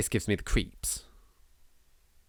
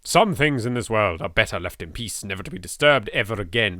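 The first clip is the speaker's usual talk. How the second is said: usual speaking voice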